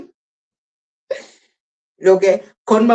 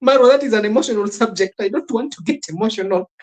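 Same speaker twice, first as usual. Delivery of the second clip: giggling mock-emotional voice, higher and fast; mocking Cleoboni; 7 semitones higher, faster than usual. Mimicking Cleoboni dodging the email question, laughing. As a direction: play her up as a teary guest, laughing through it. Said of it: "And Cleoboni was like".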